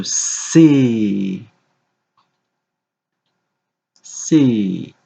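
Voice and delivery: C stretched long, gliding down; drawn-out letter. Shown as a demonstration. Teacher dictating a letter to a young pupil, drawn-out C. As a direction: draw out both ends of "C" slowly.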